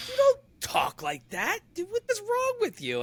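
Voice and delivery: put-on incredulous girlfriend. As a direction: squeal it like she can't believe it. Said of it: "She's like".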